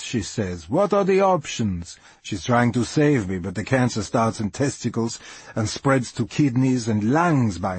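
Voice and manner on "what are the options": high, insistent question; mildly demanding, pitched up. A narrator's reading. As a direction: press hard for an answer. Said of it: "She's demanding to know the options".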